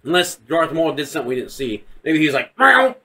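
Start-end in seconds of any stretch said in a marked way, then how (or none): 2.6-2.9 high, loud saber swish; imitated lightsaber. Guessing how Darth Maul was cut, imitated lightsaber noise. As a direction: mouth a quick saber swing.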